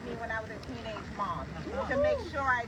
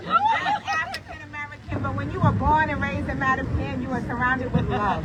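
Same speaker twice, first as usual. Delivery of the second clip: mom voice; 9 dB louder than usual. Real, not acted. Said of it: "You want to use my mom voice?".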